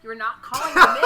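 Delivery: loud, laughing protest; loud indignant quote. Retold or imitated. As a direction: insist loudly, half laughing. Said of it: "I told him".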